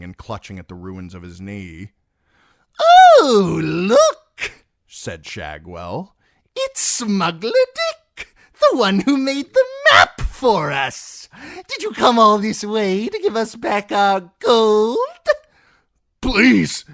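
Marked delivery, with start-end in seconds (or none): 2.8-15.0 high, loud, mocking jester voice; cruel jester. A narrator's reading. 16.2-16.8 high, loud, frightened begging; whimpering plea. A narrator's reading. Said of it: "Dick whimpered".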